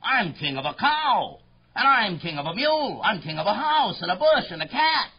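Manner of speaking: boastful royal tirade; thundering royal voice. Acted. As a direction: lay down the law to a lowly subject. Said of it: "His royal voice thundered".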